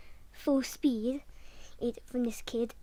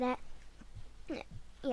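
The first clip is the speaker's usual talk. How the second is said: ordinary talk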